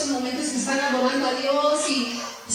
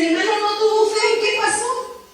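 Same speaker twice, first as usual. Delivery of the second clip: sorpresa imitada; 8 semitones higher than usual. Retold or imitated. Hermanos sorprendidos tras orar.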